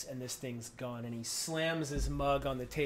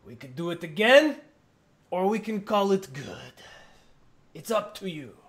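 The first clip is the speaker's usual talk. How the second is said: loud, easy giant voice; giant offer; 5 semitones higher, 9 dB louder than usual. Acted. Giant offering another round, loud and easy. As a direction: offer it casually. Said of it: "He goes".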